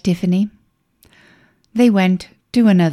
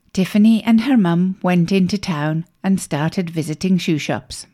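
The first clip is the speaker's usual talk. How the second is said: usual speaking voice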